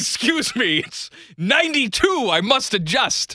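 mock-polite colonial soldier asking to adjust; high polite soldier. Soldier voiced to Washington.

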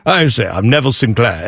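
lightly put-on British accent, pompous; mock British accent. Host imitating Neville Sinclair in a light British accent. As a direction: ham up a posh British villain line, British accent throughout. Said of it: "like, a British accent".

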